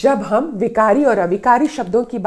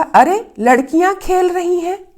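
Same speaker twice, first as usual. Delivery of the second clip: surprise; 9 semitones higher than usual. Shown as a demonstration. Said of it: "अरे एक विस्मय सूचक शब्द है".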